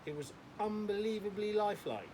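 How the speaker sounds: mock impressed, clearly not meaning it; sarcastic. Robin teasing George about his dog act, sarcastic. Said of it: "said Robin sarcastically".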